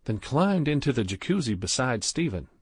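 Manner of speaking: ordinary delivery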